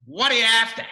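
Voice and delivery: loud, high Aussie punchline; broad Australian accent. Retold or imitated. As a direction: bark it like an Australian creator. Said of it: "broad Australian accent".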